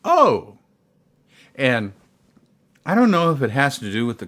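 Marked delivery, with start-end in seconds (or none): 0.0-0.6 high, loud acted "oh" of realisation; sudden realisation. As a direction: let the penny drop, high and loud. Reacts to learning where she's from.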